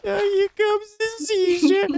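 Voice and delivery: very high, slow put-on old man voice; high, drawn-out cartoon old man; Whitey's voice. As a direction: squeak it out like a frail old coach. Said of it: "it's like his voice".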